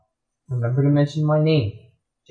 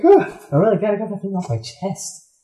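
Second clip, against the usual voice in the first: somewhat higher, lightly voiced relief; relieved confessor; 6 semitones higher than usual. Shark relieved to confess, lightly higher. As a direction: sigh it out, glad to confess. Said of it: "I'm like".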